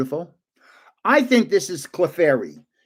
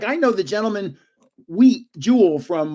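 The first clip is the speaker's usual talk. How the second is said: usual speaking voice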